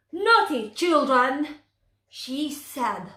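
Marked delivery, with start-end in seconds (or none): 0.1-1.6 cross. Acted. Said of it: "but stepmother was cross".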